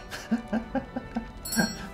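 airy embarrassed giggle; quick breathless laugh; high breathy giggle. Real, not acted. Laughs at overbuying.

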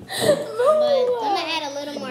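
laughing. Real, not acted. Laughing while adding sauce.

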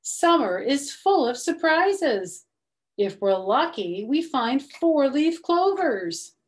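ordinary delivery

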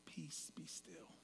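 slow breathy whisper, very soft; calm whisper. Retold or imitated. Mocking the movie Jesus calming the storm, slow whisper. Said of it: "in a very Jesus-y, calm voice".

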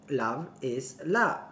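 "Love is love" stretched slowly; slow drawn motto. Real, not acted. To viewers, repeating her motto slowly. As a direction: draw each "love" out.

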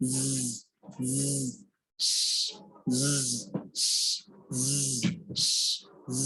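জ as a long buzzing "zzz"; isolated buzzes of জ, pauses between; demonstrating Z. Shown as a demonstration. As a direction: hold জ as a voiced hiss, no vowel. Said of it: "জ্বর ক্ষেত্রে উচ্চারণ হবে".

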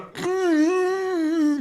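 long, very high mock squeal; imitating Kemal. Mimicking friend's reaction, a drawn-out high-pitched noise. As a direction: hold one thin high note, mimicking a friend. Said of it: "Ağzı yüzü böyle ama Kemal'in".

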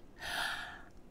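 soft acted gasp; storybook elf's excited intake of breath; gasp of realisation. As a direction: breathe in sharply at a sudden idea. Elf has sudden realisation.

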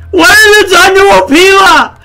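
loud, high cry of hammed-up outrage; mock anguished yell. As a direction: wail it in over-the-top disbelief. Mock outrage over ruined character.

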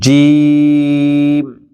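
slow, intoned জিম as in tajweed class; firm Arabic jim. Shown as a demonstration. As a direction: hold জিম long with a hard j. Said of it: "জিমটা কিন্তু শক্ত হবে".

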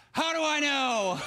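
high, bold challenge to God; acted high-pitched defiance; brazen chutzpah. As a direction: throw it out as a cheeky demand. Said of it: "That's chutzpah".

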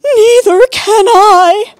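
very high, slow, loud mock wail; sobbing. A narrator's reading. Second sister agreeing, wailing in mock sobs. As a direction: draw it out in a hammed-up sob.

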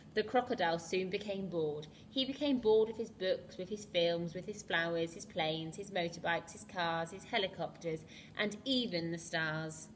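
put-on lively read-aloud to children; storybook reading. A narrator's reading. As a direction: read it like a bedtime picture book. Reads picture book to children.

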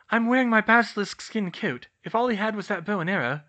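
high, loud character voice, speeding up; Hermione's voice. A narrator's reading. Hermione reassures George about her coat, high loud Hermione voice. Hermione protests, then gets hushed.

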